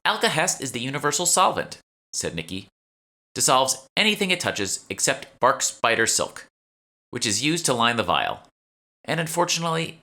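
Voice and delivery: lightly voiced shopkeeper. Nikki explains Alcahest.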